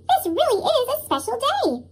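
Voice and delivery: bright, high happy line; a cheerful little engine on his big day; light, lifted delight; happily. Acted. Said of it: "he said happily".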